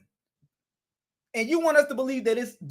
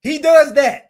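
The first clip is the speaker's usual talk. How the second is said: louder and higher, sarcastic punch; sudden loud jab; 6 semitones higher, 10 dB louder, slower than usual. Real, not acted. Commentator jeering at the scammer's habit, loud sarcastic jab. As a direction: bark it out mockingly.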